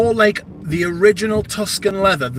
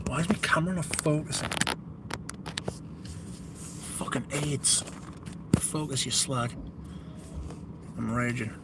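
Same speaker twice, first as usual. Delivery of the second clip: annoyed muttering; 13 dB quieter than usual. Real, not acted. Swearing at the unfocused camera.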